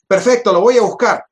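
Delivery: animated, urgent acceptance; eager agreement. Retold or imitated. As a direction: act out jumping at the deal. Acts out agreeing to collect it.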